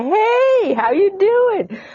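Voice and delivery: high, slow, sing-song hello; friendly Aussie greeting. Retold or imitated. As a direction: greet a passing dog walker cheerfully. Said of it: "you say".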